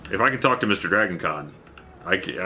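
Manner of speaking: ordinary talk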